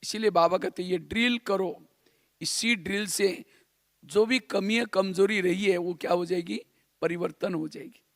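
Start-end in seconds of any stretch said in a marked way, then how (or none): none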